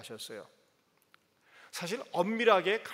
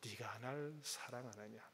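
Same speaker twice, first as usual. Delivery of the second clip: tender question; 8 semitones lower, 13 dB quieter than usual. Retold or imitated. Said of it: "물어보고 있는 것입니다".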